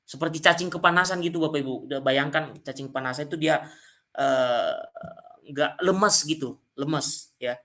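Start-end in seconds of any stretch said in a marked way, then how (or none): none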